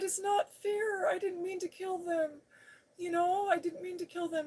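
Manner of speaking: high-pitched tearful protest, acted out; mock crying. Driver to sheriff about the deer, mock-tearful protest. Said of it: "I started crying right there".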